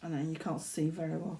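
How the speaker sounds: ordinary delivery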